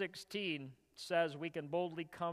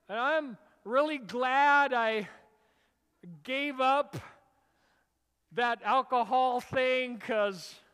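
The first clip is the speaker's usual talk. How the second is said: higher, much louder hammed-up churchgoer testimony; mock testimony; 5 semitones higher, 10 dB louder than usual. A pretend believer gushing before the congregation, mock proud. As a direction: ham up a proud convert, then slip.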